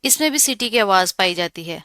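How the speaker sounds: ordinary talk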